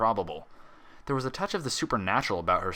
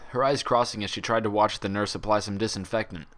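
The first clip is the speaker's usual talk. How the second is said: ordinary delivery, nothing marked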